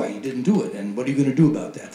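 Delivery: ordinary talk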